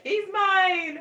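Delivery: high, loud playful claim; mock possessive squeal. Vet spotting her favorite patient, mock-possessive high squeal. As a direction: imitate someone gleefully calling dibs.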